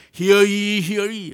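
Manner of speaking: high, loud proclaiming call; ringing crier's announcement; town crier. Acted. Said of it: "what they call a town crier".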